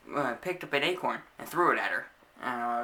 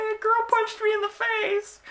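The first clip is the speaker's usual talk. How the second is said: very high, loud, drawn-out wail; mock childish wail; 7 dB louder than usual. Child telling mom about the punch, exaggerated wail. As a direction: wail the complaint out, hammed-up. Voices own wailed answer to mom.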